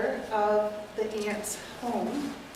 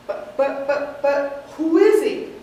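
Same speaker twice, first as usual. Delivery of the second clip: stammering, louder, slow; flustered; 7 dB louder, slower than usual. Acted. Mortimer asks who the dead man is, stammering. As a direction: sputter in disbelief. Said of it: "playing the nephew, Mortimer".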